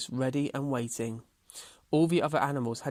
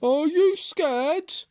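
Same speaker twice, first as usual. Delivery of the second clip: slow, loud, raised tortoise voice; challenging tortoise; 8 dB louder, much slower than usual. A narrator's reading. Tortoise dares Hare to race, loud, slow and challenging. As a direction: draw out the dare.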